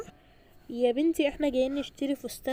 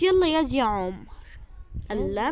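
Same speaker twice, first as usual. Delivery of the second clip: normal talking voice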